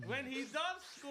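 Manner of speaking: hyped celebration. Pretend. Acting out a goal celebration.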